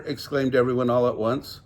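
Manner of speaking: plain reading voice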